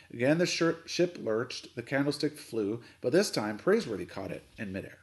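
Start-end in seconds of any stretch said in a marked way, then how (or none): none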